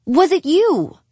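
high, loud accusing question; insistent demand. Acted. Demanding Angie answer.

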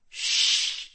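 soft, voiceless hush; hissed shush. A narrator's reading. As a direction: hiss a warning for silence. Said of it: "she hissed".

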